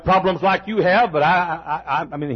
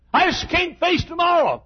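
higher, hopeless voice; despairing quote; 10 semitones higher than usual. Retold or imitated. Preacher voicing a despairing listener, hopeless. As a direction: sound like someone who can't go on. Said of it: "quoted despair".

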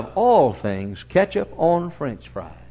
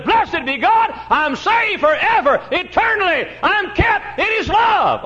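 high, loud, slow exultant cry of assurance; preacher ringing out a believer's triumphant praise; joyful shout; 6 dB louder, slower than usual. Acted. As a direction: shout it like a man sure he's saved forever. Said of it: "Fellow who says".

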